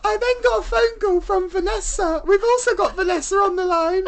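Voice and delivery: high put-on woman's voice; Wendy character voice. Wendy announcing a caller in high put-on voice.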